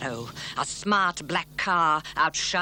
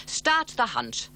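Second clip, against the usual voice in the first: a touch higher, rallying call; mild mock yell; 6 semitones higher than usual. Matt rallying the trio, a mild mock yell. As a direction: rouse the troops, lightly. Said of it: "they heard Matt yell".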